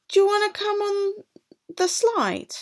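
put-on high, quick little girl asking; bright eager invitation; girl's voice. Girl invites the boy onto the slide, high and quick. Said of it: "a voice asked".

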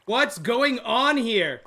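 ordinary delivery

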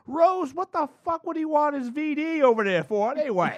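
high, loud, cranky grandma voice; a foul-mouthed old grandma; old lady voice. Retold or imitated. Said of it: "my grandmother turns to Rose and goes".

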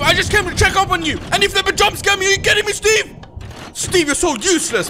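high, fast, indignant complaint. Real, not acted. Gamer scolding Steve after a jump scare, high indignant.

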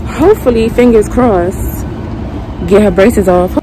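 muffled by cloth mask; speaking behind mask; through a face mask. Real, not acted.

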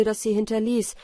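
ordinary delivery, nothing marked

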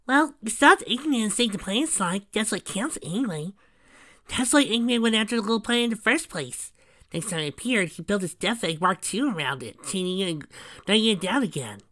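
high storybook sidekick; high-voiced young hero. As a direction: recount the adventure in a high voice.

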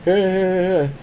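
high, loud, cheeky friend, rushing the last line; teasing friend voice. Retold or imitated. Friend joking about wasted minutes, high teasing voice. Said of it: "And my friend's like".

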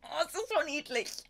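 high, gushing coo over something cute; excited high squeal; cooing in German. Real, not acted. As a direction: melt at how cute it is.

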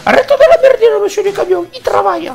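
very high, loud falsetto scolding; hammed-up shrieking telling-off in falsetto; scolding wife impression. Wife telling off Jean-Pierre for pestering a trucker, shrill scolding. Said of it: "en mode".

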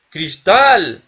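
yelling the word with glee; excited shout. Real, not acted. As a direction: shout it out, thrilled. Excited shout finding glass.

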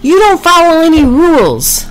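high, loud outburst; angry accusation. A narrator's reading. Priscilla loses patience with rule-breaking Packy, angrily.

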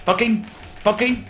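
high, loud burst of swearing; real exasperated yell; frustrated shouting. Real, not acted. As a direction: curse loudly at the fight. Frustrated outburst during game fight.

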